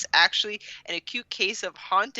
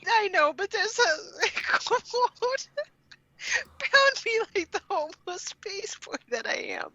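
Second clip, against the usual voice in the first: laughing through quote; 11 semitones higher than usual. Real, not acted. Reading a ridiculous book quote to friends, cracking up. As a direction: read the quote while losing it to laughter.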